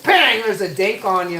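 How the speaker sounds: high, loud "Bang" burst; spring it on them abruptly and loudly; sudden loud reveal. Acted. Man mocking an unannounced flashing, loud announcement.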